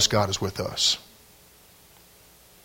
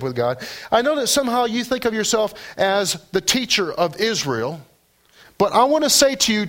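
full preaching; 9 semitones higher than usual. Acted. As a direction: preach it with rising fervor. Preaching Jesus' words to Nicodemus.

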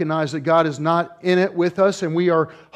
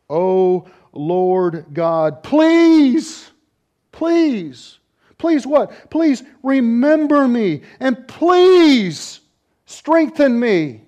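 pleading prayer; 8 semitones higher, 6 dB louder, much slower than usual. Acted. Samson begging God for strength, slow pleading. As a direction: beg God, each please rising. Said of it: "He says".

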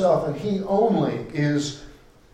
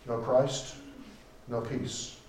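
"No Christ, no peace" repeated much softer; quiet echo; 4 semitones lower, 9 dB quieter, slower than usual. Real, not acted. Preacher repeating the refrain to the congregation, hushed and quiet. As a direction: let the repeat sink in, hushed. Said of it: "I say to you once again".

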